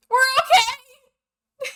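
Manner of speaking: very high, loud, drawn-out holler; distant shout. Acted. Crew over the waterfall calls back to friends, distant shout. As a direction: call up from far below, stretching it out. And you hear a.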